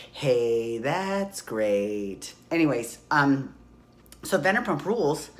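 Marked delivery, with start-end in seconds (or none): 0.1-2.3 deep mock voice. Repeated sarcastic remark, deep voice.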